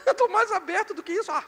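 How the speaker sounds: high, fast mock protest; a touch of a husband mocking being told to open up; mock retort. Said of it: "Você fala".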